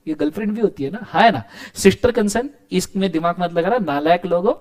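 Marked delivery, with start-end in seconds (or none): none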